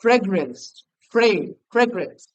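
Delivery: "ফ্রেগরেন্স" repeated with "fray" at the start; teaching pronunciation. Shown as a demonstration. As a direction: drill "ফ্রেগরেন্স", breaking off the first syllable "ফ্রে". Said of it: "কথা হলো ফ্রেগরেন্স". ("ফ্রেগরেন্স", প্রথমে ফ্রে জোর দিয়ে শেখানো)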